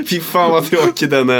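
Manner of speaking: laughing high while scolding. Real, not acted. Laughing at co-host's lame joke, high and laughing. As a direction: giggle through the complaint.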